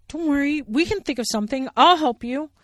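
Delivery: loud, high eager comfort; Billy Bear voice. Acted. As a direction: cheer her up fast. Billy reassuring.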